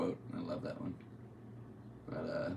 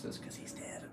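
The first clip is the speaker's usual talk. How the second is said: unvoiced whisper of a dark joke; whispered aside; 10 dB quieter than usual. Real, not acted.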